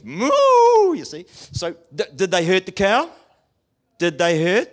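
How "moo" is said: high, loud mock moo; cow moo. Preacher playing the prodded cow with a moo. Cow's reply.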